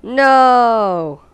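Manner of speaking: one long, loud "no"; mock dramatic cry. Player protests at the game, loud drawn-out cry. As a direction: wail it out in exaggerated protest.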